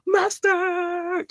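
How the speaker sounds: high, loud "Mustard!" yelled out; can't hold it in, shout it; blurted shout. Acted. Host breaking her promise and shouting the word. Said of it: "I'm not gonna say mustard anymore".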